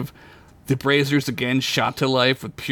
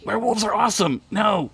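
raised, strained voice; wincing; 9 semitones higher than usual. A narrator's reading. Wounded Duncan answering Sid, wincing protest. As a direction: protest through pain, pitched up.

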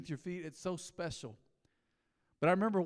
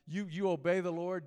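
normal talking voice

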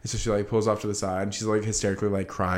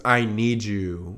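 slow plea; much slower than usual. Retold or imitated. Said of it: "Margot legit says".

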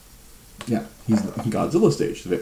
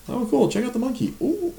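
put-on high-pitched excitement, showing a toddler a toy; retold gushing to a little kid, voice way up; excited dad.

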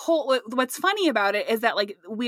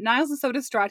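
ordinary delivery

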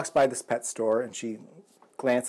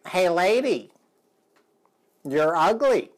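higher, louder parrot heckle; 6 semitones higher, 5 dB louder than usual. Retold or imitated. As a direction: heckle her like a cheeky parrot. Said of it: "there's the bird again".

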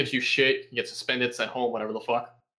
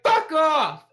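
loud, high-pitched mock outburst; angry shout; 6 dB louder than usual. Needled worker turns on his tormentor, acted angry shout. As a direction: blow up like a needled coworker. Needled coworker snapping back.

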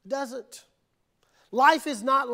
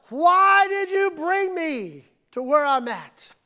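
loud, drawn-out cry, higher; shouted question to God; 5 semitones higher, 5 dB louder than usual. Retold or imitated. Pastor retelling his own complaint to God, shouting. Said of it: "I've often asked God".

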